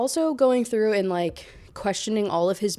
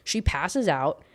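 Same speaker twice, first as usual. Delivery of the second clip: usual speaking voice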